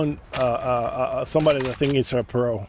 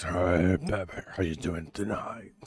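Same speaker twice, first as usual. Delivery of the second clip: Shaniqua voice; 8 semitones lower, 7 dB quieter than usual. Pretend. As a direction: purr a soft come-on in a put-on voice. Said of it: "my Shaniqua voice".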